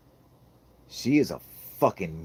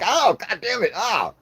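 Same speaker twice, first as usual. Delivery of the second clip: high, loud comic cry of pain; pained yell; 13 semitones higher, 7 dB louder than usual. Retold or imitated. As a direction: yelp as if being kicked. Dad being kicked by toddler.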